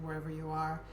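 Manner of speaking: normal talking voice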